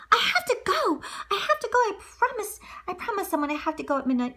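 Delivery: put-on high, urgent girl voice; hurried girl. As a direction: rush the words out. Cinderella leaving in hurry.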